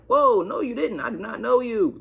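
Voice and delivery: high, loud mock protest; hammed-up indignant protest; retold loud denial. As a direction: deny it emphatically, acting it out. Acted denial to the girl.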